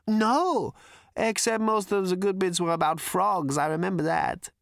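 high, amused character voice; giddy Prack. A narrator's reading. Prack recalls the bits about frogs, high and giddy.

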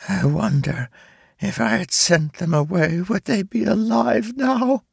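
villainous voice cracking, rising high; voice breaking. Acted. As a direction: spider mother's voice breaks. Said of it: "Her villainous voice broke as she asked".

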